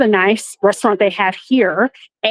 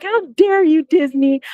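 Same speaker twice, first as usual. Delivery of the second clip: high, dramatic how dare you; indignant cry; 6 semitones higher than usual. Retold or imitated. Scolding Disney directly, high mock indignation. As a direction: fling it at Disney, pitch up. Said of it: "I was like".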